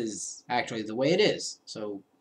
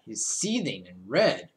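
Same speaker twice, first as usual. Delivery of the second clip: usual speaking voice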